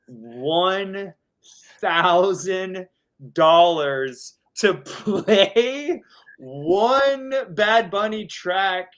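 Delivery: ONE THOUSAND DOLLARS stretched out high, word by word; drawn-out emphasis. Real, not acted. DJ revealing the huge tip to play one song, ONE THOUSAND DOLLARS drawn out high for emphasis.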